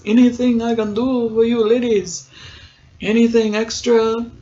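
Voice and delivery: put-on a touch of suggestive French accent. Ship's steward offering extra service to ladies, French accent. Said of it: "like, a French accent".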